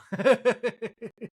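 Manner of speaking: high giggle; laughing. Real, not acted. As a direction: burst of high, staccato laughter.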